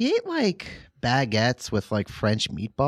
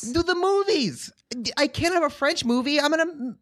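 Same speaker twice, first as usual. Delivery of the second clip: much higher, indignant squeal; high whiny protest. Acted. Gene character objecting to Rebecca's accusation, high indignant whine.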